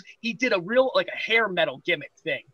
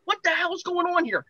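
panicked outburst; 11 semitones higher than usual. Retold or imitated. Locked door, music playing, panicking.

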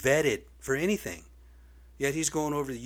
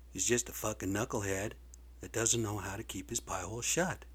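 usual speaking voice

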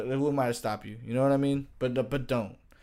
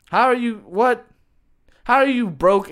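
incredulous; 8 semitones higher, 6 dB louder than usual. Real, not acted. Incredulous at broke vegan sister.

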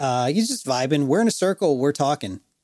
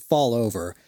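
ordinary talk, nothing marked

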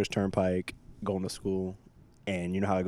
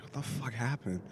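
hushed, higher confused blurt; retold startled thought; 5 semitones higher, 7 dB quieter than usual. Driver waking on the median, hushed startled blurt. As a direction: mumble it like you just jolted awake. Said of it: "I was like".

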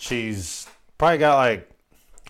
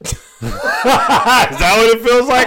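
slow, loud, laughing; laughing mock seductive; 8 semitones higher, 7 dB louder, slower than usual. Shown as a demonstration. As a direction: giggle through a sultry try. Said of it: "I can't even do it".

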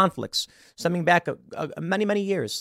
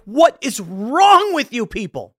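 exasperated; 7 semitones higher, 5 dB louder, slower than usual. Retold or imitated. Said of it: "And I'm like".

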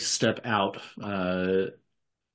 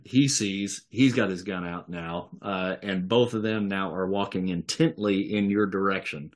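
ordinary talk, nothing marked

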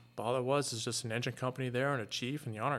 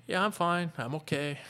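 bright, higher fake-fine reply; forced cheerful brush-off; 6 semitones higher, 3 dB louder than usual. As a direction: pretend everything's fine, pitched up. Forced reassurance while falling apart.

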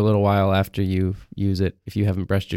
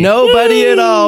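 squeaky high; 9 dB louder than usual. Acted. Joking about absent guest.

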